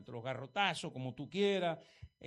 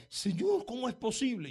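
súplica angustiada; 4 semitones higher than usual. Retold or imitated. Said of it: "Y la hermana estaba".

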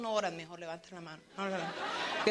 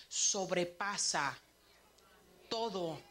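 measured emphasis; 3 semitones lower, slower than usual. Real, not acted. First take of repeated scripture phrase.

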